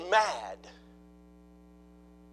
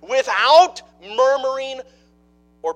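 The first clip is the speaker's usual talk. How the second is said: loud, high, driving sermon delivery; a preacher building to full force; full-force preaching; 8 semitones higher, 15 dB louder than usual. Acted. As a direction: preach it out to the back pews.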